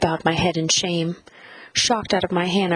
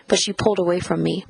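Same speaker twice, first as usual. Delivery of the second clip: ordinary delivery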